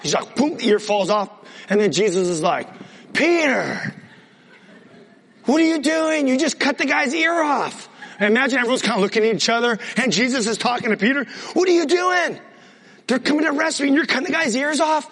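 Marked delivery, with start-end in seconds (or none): 3.1-7.9 scolding. Acted. Jesus scolding Peter after the ear is cut, exasperated high yell. 11.5-15.1 exasperated scolding. Acted. Jesus asks Peter why he cut ears off during arrest, high disbelieving yell.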